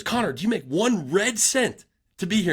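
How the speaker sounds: high, ranting mock coach; mimicking angry coach. Coach ranting at the team about the unpaid intern, high mock anger. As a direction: rant like the pissed-off coach, pitched high. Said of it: "he turns to me, he goes".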